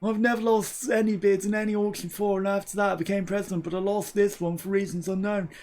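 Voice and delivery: fearless president's tweet. Acted. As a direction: read it in character as a self-important president. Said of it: "our fearless president posted a tweet that read".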